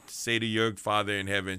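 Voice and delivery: ordinary delivery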